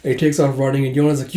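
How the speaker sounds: ordinary talk, nothing marked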